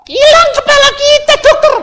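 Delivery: high, loud frightened boatman's cry; panicked boatman. Retold or imitated. Boatman cries to the doctor, high panicked voice. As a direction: squeal it out like a terrified boatman.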